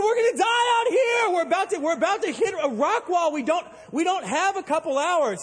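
high, loud frantic yell; panicked shout. Retold or imitated. Captain yells at the stranger on the radio, frantic shout. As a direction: scream it like you're about to crash. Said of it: "I said".

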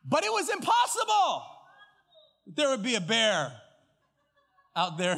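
high, loud ironic shout; mock insistence. Pastor mocking his own certainty to the congregation. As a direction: shout it with self-mocking irony. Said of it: "mocking his earlier certainty".

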